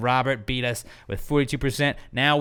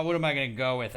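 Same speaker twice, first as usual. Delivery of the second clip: ordinary delivery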